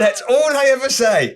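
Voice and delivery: high, exasperated outburst; comic exasperation. Real, not acted. Host fed up with pundits repeating themselves, comic exasperation.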